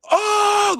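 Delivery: high, loud gasping cry; shocked cry. Retold or imitated. Fan watching a wild game, high loud shocked cry. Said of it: "made me go".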